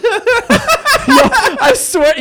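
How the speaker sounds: high laughing words. Real, not acted. Laughing while retelling.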